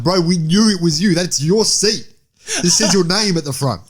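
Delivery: high, loud mock exasperation; mock exasperated voice. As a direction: squawk it out like a fed-up parliament member. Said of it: "It's like".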